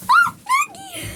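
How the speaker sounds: very high, loud call of the cat's name; excited call. Real, not acted. Greeting her kitten Maggie, high excited call.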